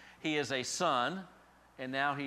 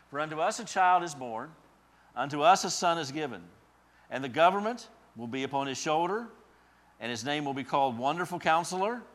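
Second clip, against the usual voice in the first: solemn building reading, louder and higher on the names; scripture reading; 5 semitones higher, 10 dB louder than usual. Acted. Preacher reading Isaiah to the congregation, proclaiming. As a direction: proclaim the verse, peaking at Mighty God. Reads Isaiah nine six aloud.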